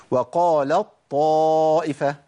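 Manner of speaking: وقالت طائفة recited as waqālaṭ-ṭā'ifa, ت gone, long ā; full idgham. Shown as a demonstration. Teacher reciting the merger, slow وقالت طائفة with doubled ṭ. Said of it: "التاء عندي بتذهب بالكلية وتبدل بطاء".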